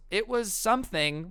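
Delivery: lightly imitated high, noncommittal reply; mock awkward politeness. Embarrassed Alice answers Chad about his dance, high mock-polite tone. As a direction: pitch it well up, as if dodging the question.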